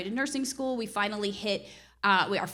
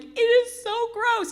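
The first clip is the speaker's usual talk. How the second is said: much higher, slow drawn-out disgust; disgusted whine; 13 semitones higher, 7 dB louder, slower than usual. Retold or imitated. Wife complaining to husband about the house, disgusted. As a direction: stretch it out in revulsion. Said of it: "I'm like".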